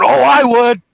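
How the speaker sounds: hammed-up eager student; eager mock reply. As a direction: jump in keen from behind. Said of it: "Meat behind him going".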